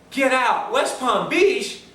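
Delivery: can't believe the coincidence; surprised man. Retold or imitated. Stranger reacts in mock disbelief to the town's name. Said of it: "He goes".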